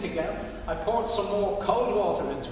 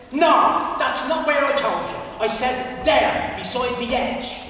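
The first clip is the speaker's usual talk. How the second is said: higher, louder, slowing on I said there; fussy correction; 5 semitones higher, 7 dB louder than usual. Acted. Fussy old man scolding. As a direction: Pat insisting on the exact spot.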